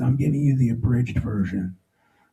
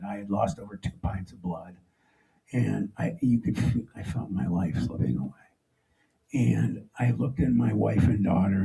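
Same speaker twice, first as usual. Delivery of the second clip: usual speaking voice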